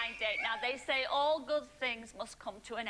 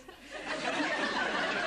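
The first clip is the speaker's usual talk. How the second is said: high laugh; 14 semitones higher than usual. Real, not acted. Host laughs at contestant's reply.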